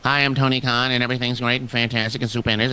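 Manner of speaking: bassless, monotone mocking impression; mocking voice with no bass in it; mock Tony Khan. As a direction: run it together on one droning note, no bass. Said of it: "there's no bass in his voice".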